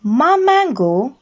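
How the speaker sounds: plain reading voice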